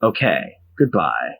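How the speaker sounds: very low, terse commander monotone; mock-boring deadpan, dropped right down low; Dragon. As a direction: report in a deep, emotionless voice. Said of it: "then Dragon will be like".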